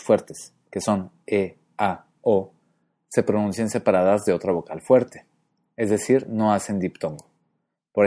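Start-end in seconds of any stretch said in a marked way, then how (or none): none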